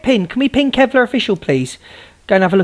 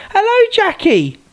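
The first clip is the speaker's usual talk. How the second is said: sing-song greeting; 11 semitones higher, slower than usual. Real, not acted. Greeting a viewer on live.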